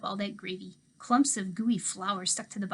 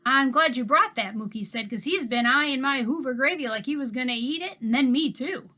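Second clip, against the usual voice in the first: put-on easygoing old handyman; 4 semitones higher, 9 dB louder than usual. A narrator's reading. Old handyman teasing the girl about her dog, louder and folksy.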